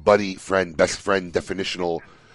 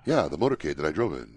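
low, softer, casual retelling; nonchalant; 5 semitones lower, 6 dB quieter, faster than usual. Retold or imitated. Co-host imitating how he talks about the motorcade, nonchalant. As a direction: toss it off as no big deal. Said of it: "he's like, nonchalantly like".

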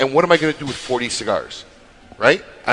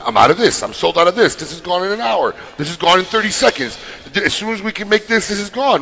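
mocking hype post; 6 semitones higher, 3 dB louder than usual. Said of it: "makes a post".

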